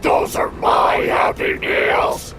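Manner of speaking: slow, loud, breathy demon shout; demonic voice. A narrator's reading. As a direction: roar it like a demon. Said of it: "said in a demonic voice".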